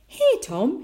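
high, loud, eager call; dad calling over. A narrator's reading. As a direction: beckon a son to a game. Dad calls Tom to play.

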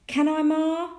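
put-on high, loud child's request; eager pleading. A narrator's reading. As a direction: beg Ma hopefully. Tom begs mother's permission.

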